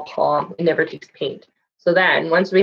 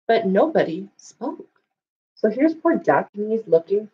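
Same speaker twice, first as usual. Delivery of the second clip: plain reading voice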